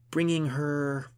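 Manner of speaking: loud, high, long-drawn verse; poem recitation. A narrator's reading. Gunhild composes a poem for her friend, reciting. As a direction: try out the line, stretching it grandly.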